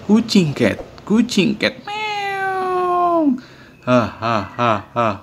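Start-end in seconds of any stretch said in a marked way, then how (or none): 1.8-3.4 high drawn-out meow; cat meow. Pretend. [name] imitating a cat on request. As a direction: mew like a cat, long and high. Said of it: "bisa menirukan suara kucing".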